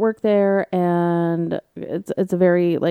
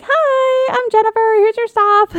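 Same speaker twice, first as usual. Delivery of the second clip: very high, sweet little voice; mock tiny sweet barista; petite woman voice; 3 dB louder than usual.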